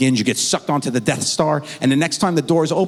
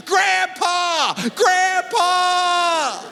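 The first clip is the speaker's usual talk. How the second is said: yelling for grandpa; much slower than usual. Retold or imitated. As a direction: call out for help like a panicked child. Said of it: "he starts yelling".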